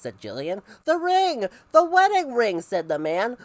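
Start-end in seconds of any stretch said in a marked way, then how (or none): 0.8-2.6 high, loud desperate cry; panicked shout. Acted. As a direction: yell it in despair. Man shouts about lost ring.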